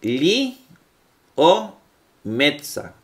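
li o metza split into slow separate syllables. Shown as a demonstration. Teacher breaking a Greek plural into syllables for students. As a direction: pause between li, o and metza. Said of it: "Si lo vemos en plural".

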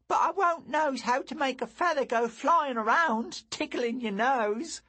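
put-on a wide-eyed boy dreaming of the world; dreamy young lad; young boy Lou.

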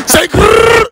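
high, loud rapper growl grrr; demo growl. Shown as a demonstration. Host demoing a rapper ad-lib, loud growl. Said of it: "say with your chest".

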